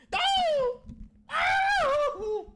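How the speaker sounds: very high, loud yelled oh; shocked cries. Real, not acted. Fan yelling at a brutal hit in shock. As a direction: scream out in shock.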